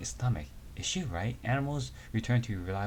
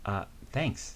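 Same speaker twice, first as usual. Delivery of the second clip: normal talking voice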